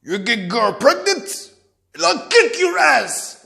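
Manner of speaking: slow threat, climbing high and loud; pissed-off father. Retold or imitated. Father threatening his son over a girlfriend, acted anger. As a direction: build to an angry, drawn-out threat. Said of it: "was getting pissed".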